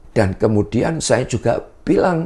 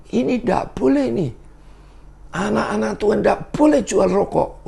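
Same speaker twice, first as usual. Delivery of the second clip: much higher, mock stern pastor voice; pastor scolding; 12 semitones higher than usual. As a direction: imitate the preacher forbidding it, pitched way up. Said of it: "Lalu dia bilang gini".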